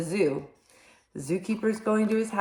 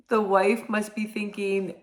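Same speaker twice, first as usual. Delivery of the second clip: plain reading voice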